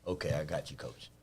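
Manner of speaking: low, soft acceptance; quiet compliance. Retold or imitated. Kid accepting the coach's correction, quietly compliant. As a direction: give in quietly, dropping low. Voices an ideal compliant player.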